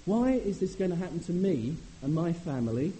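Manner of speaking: loud, high, accusing cry of why me; bitter complaint to God. Pretend. Preacher voicing a man accusing God: loud, high complaint. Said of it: "He doesn't say".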